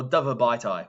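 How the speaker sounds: "Dove abiti" read as English "dove a bite-eye"; joke English Italian. Shown as a demonstration. Teacher mocking a silly student reading, English-style. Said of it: "unless a student was trying to be funny".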